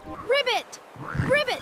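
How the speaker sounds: high croaking ribbit; frog croak. Acted. Noisy frogs in the dark canyon, high ribbit croaks.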